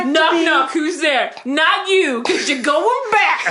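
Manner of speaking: laughing mock Saint Peter voice. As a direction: tease like a cheeky heavenly doorman. Mocking Saint Peter to immortal.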